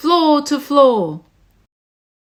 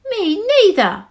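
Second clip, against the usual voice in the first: higher tiny echo; little joey; 8 semitones higher than usual. Acted. Joey siding with its mother, higher tiny voice. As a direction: squeak it like a small child. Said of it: "the young kangaroo in her pouch said".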